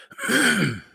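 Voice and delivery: clearing throat. Real, not acted. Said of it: "something stuck in my throat".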